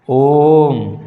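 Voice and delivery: ओम with the vowel held long for triple length; lengthened ओम demo. Shown as a demonstration. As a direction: sustain ओम like a chant. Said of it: "तीन गुना समय लग रहे हैं".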